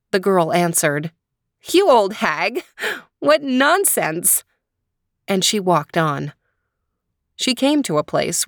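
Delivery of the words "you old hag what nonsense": high-pitched scorn, drawn out at the end; scornful. A narrator's reading. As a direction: dismiss the advice with contempt. Girl scornfully dismisses old woman.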